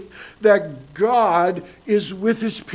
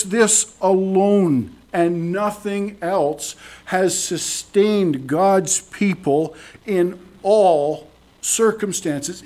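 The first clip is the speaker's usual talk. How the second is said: ordinary delivery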